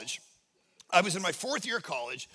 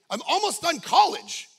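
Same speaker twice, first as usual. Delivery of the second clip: raised pitch and louder, baffled; incredulous protest; 8 semitones higher, 6 dB louder than usual. Retold or imitated. Student objecting to the guidance counselor's news, incredulous. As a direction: protest in disbelief, rising at the end.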